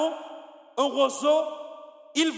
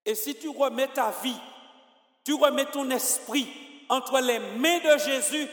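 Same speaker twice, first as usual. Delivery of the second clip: ordinary delivery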